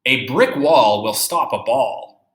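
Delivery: ordinary talk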